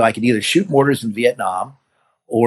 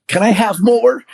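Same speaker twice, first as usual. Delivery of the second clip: eager request; 10 semitones higher, 4 dB louder, slower than usual. Retold or imitated. Saving face, asking for more.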